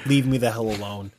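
ordinary delivery

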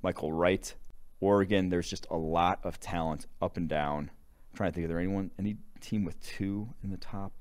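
usual speaking voice

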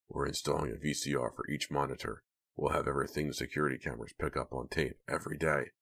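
lightly voiced busy worker; Hank explaining. A narrator's reading.

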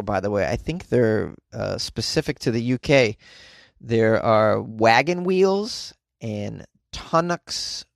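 usual speaking voice

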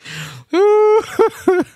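high burst of laughter; cracking up at his own impression. Real, not acted. Host cracks up after imitation.

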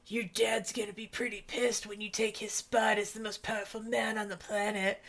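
a lightly voiced menacing warning; hushed, teasing dramatic line; mock ominous line. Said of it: "we get the little, like".

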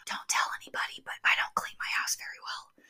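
hushed secret whisper. Acted. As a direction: whisper it like a confession. Confiding a secret, unvoiced.

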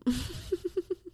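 a quick high giggle. Real, not acted. Laughs at her own bragging list. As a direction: laugh at yourself.

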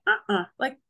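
standing up for herself, rising to no; firm refusal. Retold or imitated. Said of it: "I was like".

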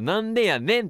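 なんでやねん high and swooping, with "ねん" placed; "ねん" set down deliberately. Shown as a demonstration. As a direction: land clearly on the "ねん" in なんでやねん. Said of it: "今、ねんを置いてる".

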